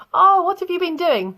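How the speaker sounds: high bright questioning lilt; high playful teacher question; mock surprised question. Teacher voicing herself asking students.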